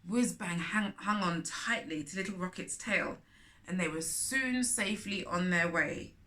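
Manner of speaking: usual speaking voice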